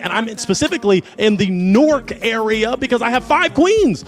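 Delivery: bright, swooping show-host patter, big rises on the hype words; game show host. Acted. Hosts the dating show.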